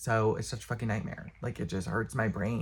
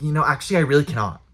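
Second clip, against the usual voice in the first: louder, clipped mock of the librarian's refusal; curt refusal; 4 semitones higher, 8 dB louder, faster than usual. Librarian refusing to watch the bag, mock loud. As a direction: mimic her snapping no at you. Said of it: "She's like".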